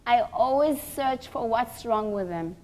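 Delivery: ordinary delivery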